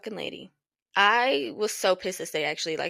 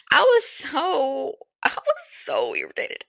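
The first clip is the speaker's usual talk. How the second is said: near tears; 7 semitones higher than usual. Real, not acted. Retelling a racist customer insult.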